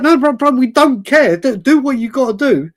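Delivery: high, loud, insistent brush-off; mock friends cutting him off eagerly; excited reassurance. Friends insist they don't care.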